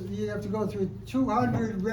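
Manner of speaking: ordinary talk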